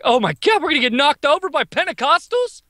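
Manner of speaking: high, incredulous retold worry; mock alarm. Customer fearing a holdup, high mock alarm. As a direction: pitch it up in comic disbelief. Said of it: "I'm like".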